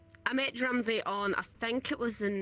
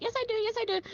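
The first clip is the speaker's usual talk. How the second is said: panicked reply; 13 semitones higher, much faster than usual. Retold or imitated. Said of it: "And I'm like".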